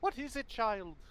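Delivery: high-pitched Scottish woman's voice; attempted Scottish accent. Mother answering her child, high attempted Scottish accent. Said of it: "my best attempt at a Scottish accent".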